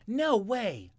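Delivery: high, very loud refusal; screamed. A narrator's reading. Tiny Jimmy screams refusing vegetables. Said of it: "screamed Jimmy".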